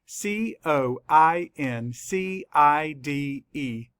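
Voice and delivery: slow, letter-by-letter spelling; measured classroom spelling; spelling aloud. Shown as a demonstration. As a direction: spell it out slowly, each letter separate. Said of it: "Let's spell it".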